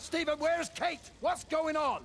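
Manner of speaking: rising panic as he demands where Kate is; urgent alarm. Acted. Repeats question, urgent alarm.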